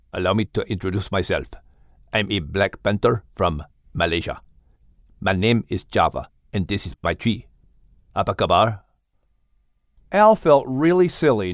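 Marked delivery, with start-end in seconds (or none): 0.1-7.5 put-on low, mysterious panther in a Malaysian accent; deep-voiced big cat with a Malaysian accent. Voices Java, accent named earlier. 8.1-8.8 put-on low panther greeting in a Malaysian accent; deep-voiced Malay greeting from a big cat.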